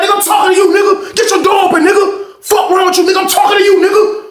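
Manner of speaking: high-pitched, relentless imitated challenge; acted angry demand. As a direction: hammer it out high, calling him out. Acts out quarrel before fight.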